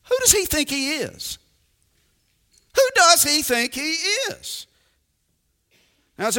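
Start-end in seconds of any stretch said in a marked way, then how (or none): 0.0-1.4 indignant scoff. Retold or imitated. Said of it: "In other words". 2.8-4.7 indignant scoff. Retold or imitated. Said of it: "In other words".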